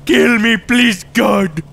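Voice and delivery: mock despair shout. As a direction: ham up a dying plea. Melodramatic despair.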